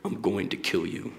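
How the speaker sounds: a hint of a dark, deep voice saying it; deep menacing voice. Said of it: "he would say it in a very deep voice".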